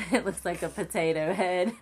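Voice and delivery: loud, amused outburst between laughs; laughing. Real, not acted. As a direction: laugh loudly through the words. Laughing at own bad drawing.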